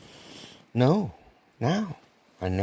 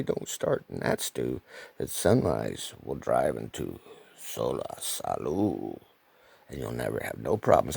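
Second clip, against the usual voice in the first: put-on deep old chap voice; 14 semitones lower, 4 dB quieter than usual.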